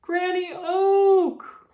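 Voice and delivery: a very high, loud, slow cry; calling out. Acted. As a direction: call her name across the clearing. Squirrel calls out in alarm.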